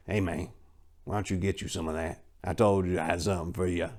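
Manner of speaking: low, soft sly voice of a hustler; lightly voiced sleazy friend, grinning; greasy grin. A narrator's reading. Sly pushing his friend toward the women, grinning. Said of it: "he said with a greasy grin".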